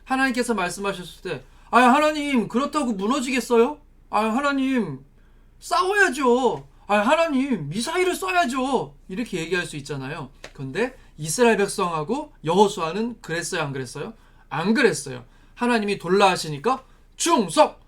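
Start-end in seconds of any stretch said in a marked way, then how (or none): 1.7-8.9 high, drawn-out whiny objection; mock whining protest. Acting doubters arguing with God. 17.2-17.8 high, firm soldier's salute; emphatic acted pledge of loyalty; military salute. Acting a soldier's salute to God.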